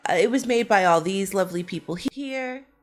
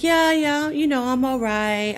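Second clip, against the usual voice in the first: much higher, louder, brushing it off; breezy injured woman; 7 semitones higher, 3 dB louder than usual. Retold or imitated. Injured woman brushing off her friend's worry, much higher and louder. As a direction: squeal that it's all fine. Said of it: "And the other lady's like".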